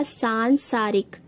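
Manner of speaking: सांसारिक with long aa, "saan-saarik"; correct form. Shown as a demonstration. Teacher giving the correct word, सांसारिक with long saan. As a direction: draw out the first syllable of सांसारिक. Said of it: "शुद्ध रूप इसका हो जाएगा".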